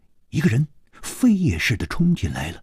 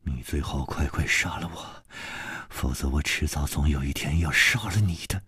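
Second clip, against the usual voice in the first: low, quiet, cold menace; stern, harsh; 10 semitones lower, 9 dB quieter than usual. A narrator's reading. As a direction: say it low and deadly serious. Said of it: "厉声说".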